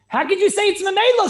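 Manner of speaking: loud, high-pitched objection; incredulous challenge. Retold or imitated. Teacher voices a scholar's objection to students, loud incredulous challenge. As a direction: raise the voice sharply in disbelief.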